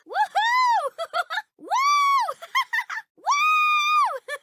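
very high loud cheering whoop; victory whoop. Acted. Game character celebrating a win with loud whoops. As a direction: whoop and laugh with joy.

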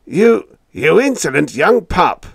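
loud, high furious rant; raving. A narrator's reading. Skipper raving at young Biggs, furious loud shout. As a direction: rave at the upstart. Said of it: "raved the skipper".

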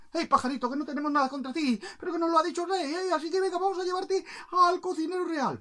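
high, loud, hurried patter; apologetic soldiers. Acted. Soldiers explain the arrest to the bird, high and hurried.

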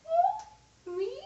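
squealing fan. Retold or imitated. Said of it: "you're gonna be like".

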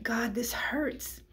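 slow, breathless whisper through tears; tearful whisper. Real, not acted. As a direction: whisper the pain to God, barely voiced. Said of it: "Like, I am crying".

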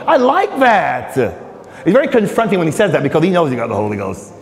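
ordinary talk, nothing marked

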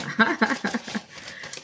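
breathy string of chuckles; happy laugh. Real, not acted. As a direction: laugh it off joyfully. Laughing after hopeful closing words.

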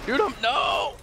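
urgent shout. Real, not acted. Telling teammate to shoot the enemy, urgent shout.

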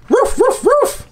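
high-pitched playful puppy barks; three high mock woofs; pretend dog bark. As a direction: bark like an excited toy pup. Voicing the toy dog barking.

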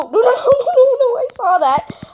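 high laughing no's; laughing panic. Real, not acted. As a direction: squeal with nervous laughter. Laughing panic during fight.

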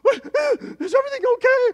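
high, loud mock-anxious mother voice; worried mom. Son mimicking his mom's anxious check-in, high and loud. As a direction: squeak it out like a fretting mom. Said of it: "But my mom, you know, she just".

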